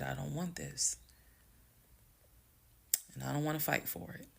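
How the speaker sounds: soft, near-whispered refusal; calm. Retold or imitated. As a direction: refuse quietly and evenly. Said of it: "exactly how I was talking".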